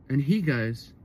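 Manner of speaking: ordinary talk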